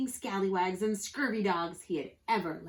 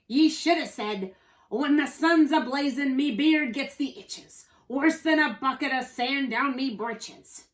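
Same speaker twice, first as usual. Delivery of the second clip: loud, raised-pitch pirate scolding; pirate holler; 5 semitones higher, 7 dB louder than usual. A narrator's reading. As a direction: holler the correction in salty pirate talk. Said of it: "Pete hollered".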